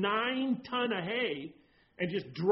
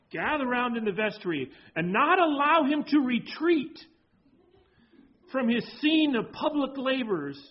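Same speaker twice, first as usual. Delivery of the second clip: preaching a quote; 5 semitones higher, 6 dB louder than usual. Acted. Pastor reading the quote to the congregation, preaching. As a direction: proclaim the old quote with force.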